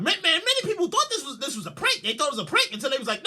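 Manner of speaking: usual speaking voice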